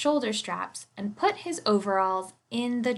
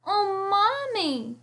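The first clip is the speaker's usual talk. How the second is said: put-on high, slow child's cry; a little girl calling out, much higher; little girl calls out to her mother, high and slow; exclaiming child; 11 semitones higher, much slower than usual. A narrator's reading. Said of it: "she exclaimed".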